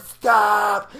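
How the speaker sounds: high loud panicked cry; scared shout. Retold or imitated. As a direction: yell stop in fright. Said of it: "she goes".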